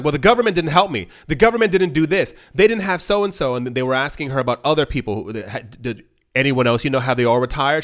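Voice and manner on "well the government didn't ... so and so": higher, quick, aggrieved retelling; complaining old woman. Retold or imitated. Retiree blaming government to interviewers, higher aggrieved voice. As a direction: pass the blame, a little too fast. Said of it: "And she was like".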